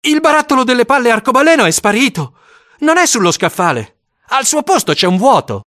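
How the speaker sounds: put-on boy's excited cry.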